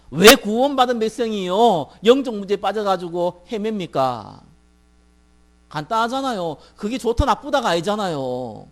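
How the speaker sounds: loud, slow pulpit challenge; impassioned preaching. Real, not acted. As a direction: hurl drawn-out questions at the congregation.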